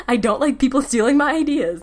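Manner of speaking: high, loud, through a laugh; laughing protest. Real, not acted. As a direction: laugh as you insist on it. Laughs while admitting a pet peeve.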